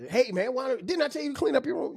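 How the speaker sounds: a high-pitched, fast scolding yell at a son; hammed-up angry dad, quick and high; mock yell. Dad imitating how he yells at his son about his room. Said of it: "I might have to yell at".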